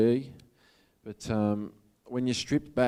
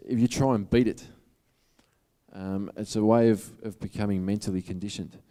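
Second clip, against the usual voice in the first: ordinary talk, nothing marked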